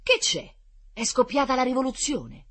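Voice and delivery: high, loud alarmed query; startled question. A narrator's reading. Druggist at his shop door hearing the commotion, startled. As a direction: ask it high-pitched, taken aback.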